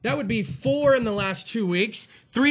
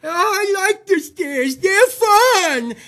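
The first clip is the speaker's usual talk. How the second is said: high, wheezy hammed-up character voice, much higher; Fatty McGee; 9 semitones higher, 5 dB louder, much slower than usual. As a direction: play a cheerful wheezing fat man on the stairs. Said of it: "Fatty McGee would take the stairs".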